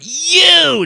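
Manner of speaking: very high loud shout; single accusing yell; mock yell. Anna Nicole singling him out, shouted. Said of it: "she's like".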